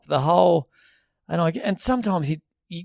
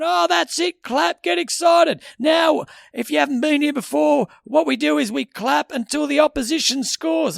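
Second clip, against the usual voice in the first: rev up guy; 10 semitones higher, 4 dB louder than usual. Retold or imitated. Mocking the rev up guy whipping up the crowd, loud MC shout. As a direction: ham up the arena MC. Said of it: "And he's going".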